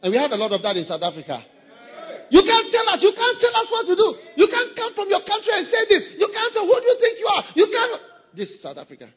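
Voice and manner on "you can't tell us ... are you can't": high, loud mocking shout of a rebel; mocking high defiant yell; defiant shouting member. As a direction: shout it like a stubborn church member who won't be told. Preacher mimics defiant members.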